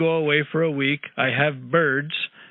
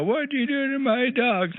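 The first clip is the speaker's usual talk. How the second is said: much higher, put-on voice of an upset wife; mock wife; 9 semitones higher than usual. Caller imitating his wife over the dogs, high mock voice. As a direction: mimic the wife scolding, pitched way up. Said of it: "Barbara's gonna go".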